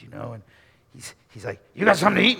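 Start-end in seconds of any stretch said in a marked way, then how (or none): 1.7-2.4 loud, raised biker demand; big biker dude. Acted. Big cycle dude to terrified Nathaniel, loud demand. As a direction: bellow it like a menacing biker. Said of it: "The next guy's this big".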